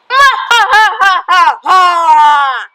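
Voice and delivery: loud villain laugh; evil cackle. Acted. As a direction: cackle like a supervillain.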